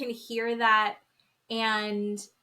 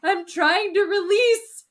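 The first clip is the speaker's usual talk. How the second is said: high, loud hammed-up wail; mock tearful breakdown; 10 semitones higher, 7 dB louder than usual. Host retelling woman's breakdown to co-host, exaggerated high wailing. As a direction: plead it loudly as if falling apart. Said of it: "Full breakdown".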